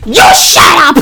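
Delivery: loud road-rage comeback; screamed comeback. Retold or imitated. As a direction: re-enact yelling back at a calm driver. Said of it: "Like, I'm screaming".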